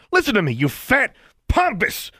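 high furious shout. Acted. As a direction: bellow insults at the governor. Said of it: "he began shouting again".

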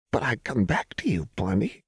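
high, half-whispered brittle cheer; brittle smile. A narrator's reading. Jinx reassuring Lux she would return, brittle. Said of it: "said with a wide, brittle smile".

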